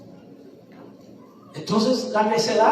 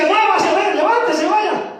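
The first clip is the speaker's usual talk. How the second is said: louder, higher barking coach; coach yelling; 6 semitones higher, 4 dB louder than usual. Retold or imitated. Coach shouting at a pampered teen, loud. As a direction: push the lazy player hard. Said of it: "un coach que le dice".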